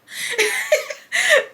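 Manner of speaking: real high-pitched giggle; high laugh. Real, not acted. Laughs after aside to viewers.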